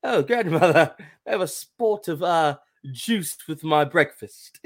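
high, prim mock-British voice with laughs; grandma mocking posh English manners; mock proper English. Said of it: "Who is this English".

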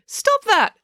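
very high-pitched objection; indignant protest. A narrator's reading. Joe telling April to stop, high indignant protest.

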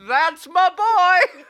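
high, loud, slow holler; proud grandma. Pretend. Grandma recognizing her grandson's fart, proud comic shout. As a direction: crow it slowly as a proud granny.

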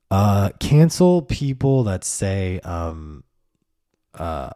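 ordinary talk

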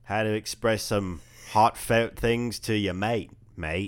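slow, hammed-up New Zealand accent, loud; bad New Zealand accent. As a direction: badly fake a Kiwi accent, drawing the words out. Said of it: "Let's work on your New Zealand accent".

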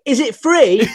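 high, loud hopeful question; hopeful mock query. Skint actor asking the bar staff, high hopeful voice. Acting out asking if drinks free.

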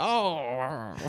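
high toy voice saying oh. Pretend. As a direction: squeak it like the toy. Imitating the toy's sound.

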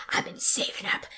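whispered menacing line; menacing client. Retold or imitated. Burly client leaning into her face, whispered menace. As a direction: breathe it like a looming threat. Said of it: "and he goes".

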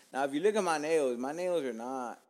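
ordinary talk

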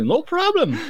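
high-pitched breezy mock reply; carefree no problem. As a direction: toss it off cheerfully to the policeman.

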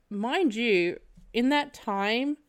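ordinary talk

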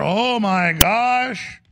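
slow drawn-out mock gasp; mock astonishment. Real, not acted. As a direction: stretch it out in fake shock.

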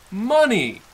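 ordinary delivery

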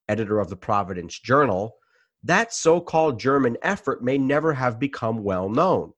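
ordinary talk

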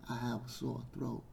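quiet sore-throat aside; hushed aside. Pretend. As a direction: drop right down after the shouting. Drops voice after screamed list.

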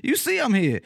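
high-pitched mock indignation; indignant retort. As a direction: snap back at rude passersby, comically affronted. Retelling indignant retort to passersby.

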